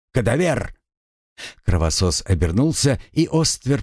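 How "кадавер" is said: sharp call across the room. A narrator's reading. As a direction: call his name sharply. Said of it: "окликнул его".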